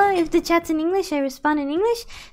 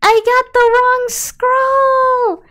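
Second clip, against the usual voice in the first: anguished wail; 8 semitones higher, 9 dB louder than usual. Real, not acted. Player meant to use the mining one, loud wailing. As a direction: wail it in despair. Laments using the wrong scroll.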